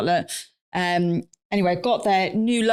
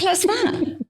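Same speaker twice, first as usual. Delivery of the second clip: outraged echo; 11 semitones higher than usual. Real, not acted. Echoing friend with outrage.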